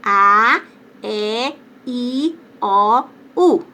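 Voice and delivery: "A, E, I, O, U" com E e O abertos; vogais abertas. Shown as a demonstration. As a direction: recite "A, E, I, O, U" separadamente, abrindo a boca. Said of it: "vogais com pronúncia aberta". ("A, E, I, O, U" em som aberto, pausado)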